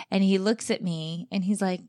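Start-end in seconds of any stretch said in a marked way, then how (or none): none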